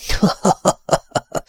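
high, toneless wheezy cackle; raw, breathy distorted laugh. A narrator's reading. The Tiger mocks his son, harsh cackle. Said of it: "Kacagott nyersen a színtelen torszang".

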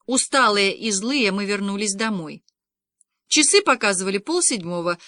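ordinary delivery, nothing marked

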